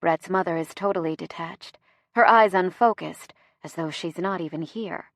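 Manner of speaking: usual speaking voice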